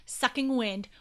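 usual speaking voice

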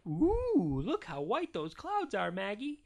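lightly voiced mom, high drawn-out "Ooh"; cooing Mama. A narrator's reading. Mama showing baby Maggie the clouds, lightly cooing. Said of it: "And Mama said".

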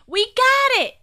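high, loud victory cry; triumphant yell. A narrator's reading. Peter with the nose trapped, yelling triumphantly. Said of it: "he yelled triumphantly".

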